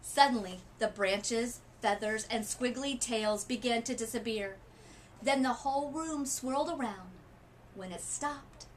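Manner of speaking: usual speaking voice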